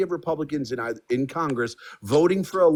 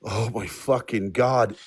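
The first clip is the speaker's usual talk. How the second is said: slow, drawn-out exasperation; exasperated; 6 semitones lower, much slower than usual. Real, not acted. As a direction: groan it out in disbelief. Exasperated at repeated question.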